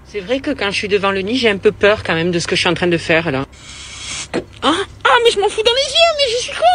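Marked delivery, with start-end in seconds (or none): 4.6-6.6 much higher yelping cries; high distressed wail; pained cry. Real, not acted. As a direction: yelp as the spray hits your eyes. Sprays herself in the eyes.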